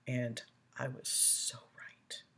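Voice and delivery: slow soft whisper; whispered aside. Real, not acted. Whispering to viewers about her grown son. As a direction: let it slip out under your breath.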